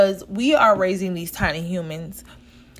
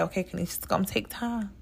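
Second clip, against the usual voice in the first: much softer, murmured to herself as self-talk; telling herself quietly; 10 dB quieter, faster than usual. Retold or imitated. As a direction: quietly reassure yourself. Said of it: "where I'm like".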